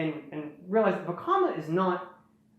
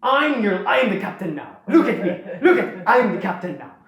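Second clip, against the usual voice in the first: louder, higher proclamation; rally the army, hammed-up captain; mock battlefield announcement, loud and high; commanding call to the horde; 4 semitones higher, 5 dB louder than usual. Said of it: "Vakama goes".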